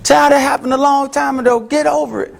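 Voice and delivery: high, impatient brush-off; dismissive advice. Acted. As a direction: tell her to move on, dismissive. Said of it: "Haven't you heard that before".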